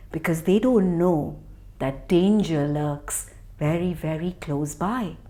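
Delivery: low, soft ominous telling; quiet storyteller warning of danger; hushed. A narrator's reading. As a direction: drop the voice for the danger.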